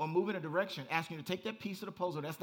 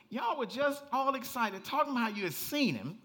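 higher and louder, building to shouted hey hey hey; preaching at full force; 10 semitones higher, 11 dB louder than usual. Acted. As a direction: preach it up to a roaring climax. Preaching climax, rising to shouts.

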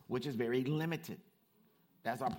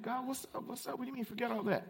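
baffled questioning; 12 semitones higher, faster than usual. Acted. Acts baffled believer questioning God.

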